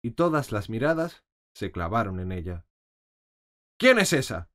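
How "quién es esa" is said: loud, stern, higher demand; severe queen. A narrator's reading. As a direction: snap the question like a harsh queen. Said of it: "preguntó la reina con severidad". (reina severa, voz alta y dura)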